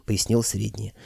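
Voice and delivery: ordinary delivery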